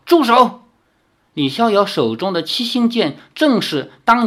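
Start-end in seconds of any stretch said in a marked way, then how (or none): 0.1-0.6 high loud barked stop; shouted command. Acted. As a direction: yell it to halt the attack. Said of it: "喝道".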